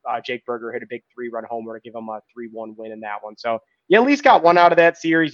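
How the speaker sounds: ordinary delivery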